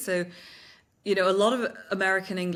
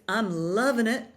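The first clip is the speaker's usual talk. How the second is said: American LOVIN' IT, dropped G, higher; louder, punchy American slogan delivery; American accent; 4 semitones higher, 4 dB louder than usual. Shown as a demonstration. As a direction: sell it like an American ad. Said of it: "they just have an American accent to them".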